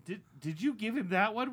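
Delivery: usual speaking voice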